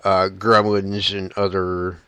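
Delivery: ordinary talk, nothing marked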